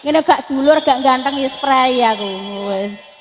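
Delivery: ordinary delivery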